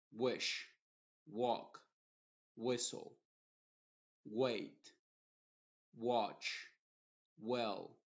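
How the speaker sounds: each English word read slowly with a clear rounded W sound. Shown as a demonstration. Teacher reads W words, each opening on a clear W. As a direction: model every word, starting on a rounded W. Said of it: "vamos a pronunciarla como una W".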